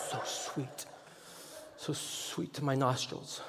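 hushed, slow, soft savoring; savoring whisper. Pretend. Preacher mocking how sweet offense smells, hushed savoring. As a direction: breathe it in like a perfume. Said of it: "the great offense of twenty eighteen".

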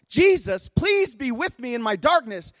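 very high, strained retold prayer; impassioned imitated pleading. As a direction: cry out her prayer, pitched far up. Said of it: "she's pleading with Jesus".

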